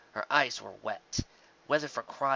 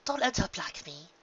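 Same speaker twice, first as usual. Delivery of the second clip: Rarity warning; 6 semitones higher than usual. Acted. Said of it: "Rarity warned".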